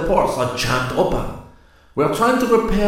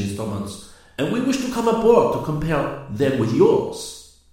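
reassuring; 7 semitones higher, 7 dB louder than usual. A narrator's reading. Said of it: "The Frenchman spoke reassuringly".

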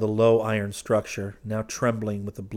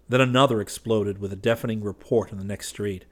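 ordinary delivery

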